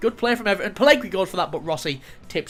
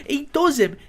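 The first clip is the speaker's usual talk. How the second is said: laughing; 8 semitones higher, 6 dB louder than usual. Real, not acted. Laughing at the replay.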